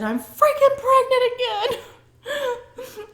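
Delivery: high, slow wail between laughing and sobbing; laugh-crying. Retold or imitated. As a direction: break into tearful laughter. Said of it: "I started sobbing".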